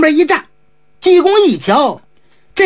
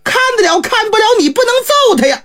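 higher and fast, rising in protest; anxious, angry father; 7 semitones higher, faster than usual. A narrator's reading. Squire protesting as the monk hits his son, higher and fast. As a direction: snap at the monk in alarm. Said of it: "当时就急了".

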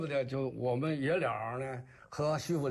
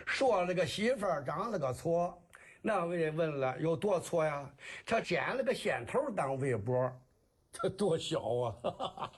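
comic kuaishu storytelling in Jinan dialect. Shown as a demonstration. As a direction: tell it as Shandong rhymed patter. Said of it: "您这个济南话". (济南话山东快书)

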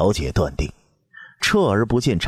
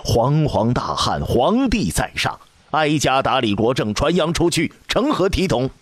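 stern shout; 8 semitones higher than usual. A narrator's reading. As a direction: thunder it down from the throne. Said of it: "喝道".

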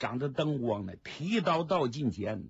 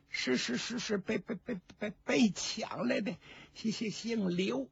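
high, softer, stuttering with fear; terrified stammer; 9 semitones higher, 3 dB quieter than usual. Acted. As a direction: stammer out the answer, too scared to finish a word. Terrified old woman stammers answer. (吓得结结巴巴，声音高而发虚)

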